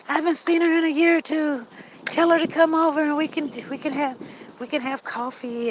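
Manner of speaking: high, sweet invitation; sugary mother-in-law. Retold or imitated. Mother-in-law inviting her over for coffee, sugary. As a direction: coo it like a fake-friendly mother.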